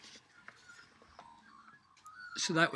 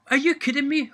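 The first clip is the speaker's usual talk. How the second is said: much higher, louder disbelieving cry; incredulous apprentice; 13 semitones higher, 13 dB louder than usual. Retold or imitated. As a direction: blurt it out in shock as the young lad.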